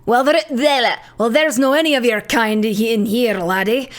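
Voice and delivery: put-on emphatic Scottish-accented governor. Prison governor sending a foreign student away, Scottish accent. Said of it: "Do you know how to do a Scottish accent?".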